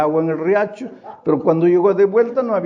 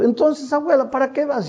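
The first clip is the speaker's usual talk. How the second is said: higher, teasing grandson voice; a hint of a scoffing grandson, higher; mocking grandson; 7 semitones higher than usual. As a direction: needle the forgetful grandmother. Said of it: "el nieto mayor, el más burlón".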